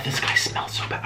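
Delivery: hushed aside about a smelly guy; whisper. Real, not acted. To the camera about her date, whispered. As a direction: drop to a secret whisper.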